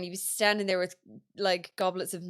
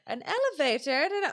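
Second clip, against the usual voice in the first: higher, mock-clueless maid; playing dumb; 7 semitones higher, 3 dB louder, faster than usual. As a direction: feign innocence, rising up high. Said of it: "I used to love playing dumb then".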